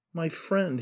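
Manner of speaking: softly faltered, a touch horrified; faltering in horror. A narrator's reading. Man overcome with horror at her memory, faltering. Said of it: "he faltered, overcome with horror".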